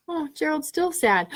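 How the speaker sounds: ordinary delivery, nothing marked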